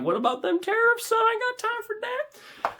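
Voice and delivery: high, hammed-up folksy protest; mock complaint. Buyer grumbling about shipping tariffs, mock protest.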